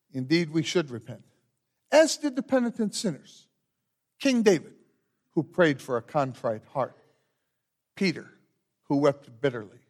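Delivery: measured, solemn liturgy voice; liturgical leading. Acted. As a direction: lead the congregation through the rite. Pastor leads confession and absolution liturgy.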